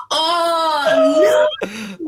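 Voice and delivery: loud high "oh", then a dropped "no"; mortified realisation. Retold or imitated. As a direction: re-enact a laughing, embarrassed wail. Retold horrified realisation of blunder.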